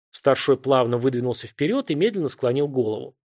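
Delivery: usual speaking voice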